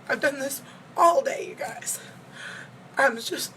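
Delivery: whispered through tears, fading away; worn out from crying, whispering to viewers; tearful whisper. Real, not acted. Telling viewers she's cried all day, in a tearful whisper. Crying after failed heart test.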